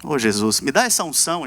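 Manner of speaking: ordinary talk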